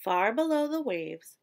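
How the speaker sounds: ordinary delivery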